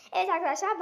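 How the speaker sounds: normal talking voice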